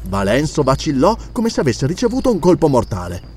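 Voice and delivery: plain reading voice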